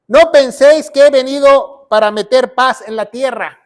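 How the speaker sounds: loud, emphatic verse reading; booming declaration of Bible words; scripture proclamation. Acted. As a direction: proclaim it forcefully to the congregation. Reads Jesus' words from scripture.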